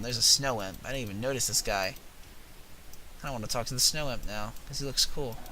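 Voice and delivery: ordinary talk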